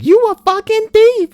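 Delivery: very high, loud mock screech; hammed-up high-pitched accuser; shrieking angry woman. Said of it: "And she's like".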